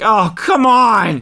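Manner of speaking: loud, high, drawn-out protest; mock exasperated yell; exasperated reader. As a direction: cry out in dismay. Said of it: "He's reading through this like".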